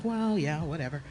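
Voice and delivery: dismissive mom. Retold or imitated. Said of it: "She's like".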